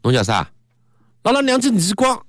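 punchy, high-pitched Shanghainese threat; forceful Shanghainese dialect. Shown as a demonstration. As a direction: act out a Shanghainese quarrel, pitch shooting up. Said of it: "必须得用上海方言读出来才有劲儿".

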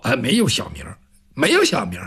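a grudging low reply; Bao Zheng. Acted.

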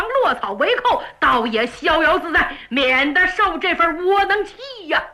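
a rash youngster egging his friend on; eager servant boy. Acted. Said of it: "王宝说".

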